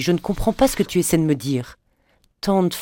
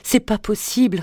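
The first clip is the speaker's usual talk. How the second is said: higher whispered "c'est pas possible", stressing "c'est"; "c'est pas possible" whispered higher, falling at the end; anguished whisper; 7 semitones higher than usual. A narrator's reading. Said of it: "répéta-t-elle".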